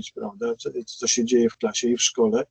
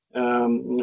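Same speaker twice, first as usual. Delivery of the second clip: ordinary delivery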